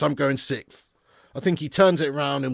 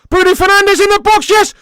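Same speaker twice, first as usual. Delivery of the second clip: very high, loud hopeful shout; excited yell; 13 dB louder than usual. Real, not acted. Fan calling Bruno's chance to chat, screaming.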